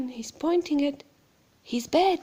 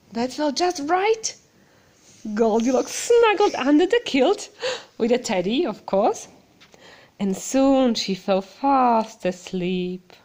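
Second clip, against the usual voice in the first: ordinary delivery, nothing marked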